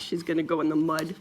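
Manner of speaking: ordinary delivery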